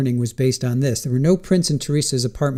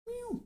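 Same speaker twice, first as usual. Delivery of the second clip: high, soft imitated meows; soft meow at cat; 19 dB quieter than usual. Host meowing to lure her cat, soft and high.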